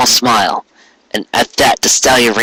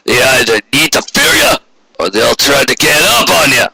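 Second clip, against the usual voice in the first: gruff boss Quarry; 4 semitones higher than usual. A narrator's reading. Boss calling from his office to his clerk, a cynical lecturing voice. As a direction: voice him as a hard-nosed boss laying down the law.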